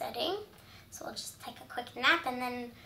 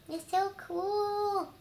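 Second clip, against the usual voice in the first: higher, slow wonder; awed delight; 8 semitones higher, much slower than usual. Real, not acted. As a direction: draw out the awe.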